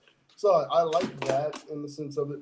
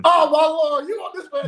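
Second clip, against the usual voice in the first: high, loud disbelieving yelp; shocked outcry; 13 semitones higher, 7 dB louder than usual. Real, not acted. Panelist reacting to [name] laying into [name], shocked outcry. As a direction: cry out in alarm, pitched way up.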